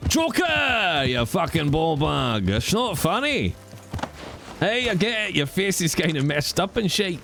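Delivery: high-pitched, indignant Scottish rant; hammed-up Scottish scolding; Scottish Batman. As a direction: tell off a villain in broad Scots. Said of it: "Scottish Batman".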